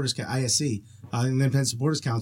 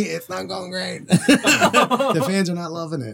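laughing slowly; 5 semitones higher, slower than usual. Real, not acted. Joking about club's struggles.

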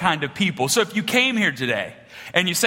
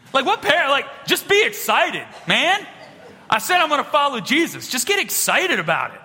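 high-pitched mock exasperation; exasperated child; 8 semitones higher than usual. Ten-year-old to dad who won't get excited, mock exasperation. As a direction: plead with mounting indignation, played for laughs.

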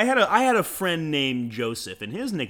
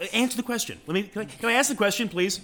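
much higher, fast whiny pleading; exaggerated cartoon intonation; 8 semitones higher, faster than usual. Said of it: "You have a voice like this".

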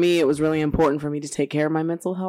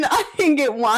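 higher, laughing complaint; laughing whine; 8 semitones higher than usual. Real, not acted. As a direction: laugh while griping.

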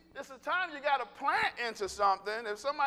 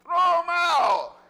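slow, high, booming shout; emphatic shout; 7 semitones higher, 10 dB louder, much slower than usual. Real, not acted. Preacher orders hoarders to throw stuff out, shouting. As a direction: belt it out, each word stretched. Urging congregation to dump hoarded junk.

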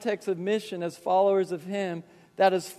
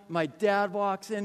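usual speaking voice

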